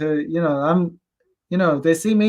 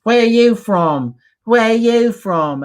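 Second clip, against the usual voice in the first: slow, higher, mocking put-on British girl voice; 6 semitones higher, 4 dB louder, slower than usual. Mocking the British girl who interrupts him at the bar, slow and high. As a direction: drag it out like a snooty girl demanding answers. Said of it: "She's like".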